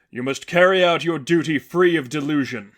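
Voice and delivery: forceful holy figure; stern seraphim. A narrator's reading. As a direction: lay down the law. Seraphim commands duty.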